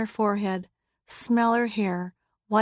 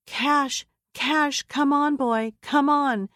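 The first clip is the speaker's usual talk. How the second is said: raised, slowed call to a dog; urgent higher call to the shepherd, slowed down; 6 semitones higher, 3 dB louder, slower than usual. Acted. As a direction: call him to you, drawn out and higher. Girl calls her dog while fleeing.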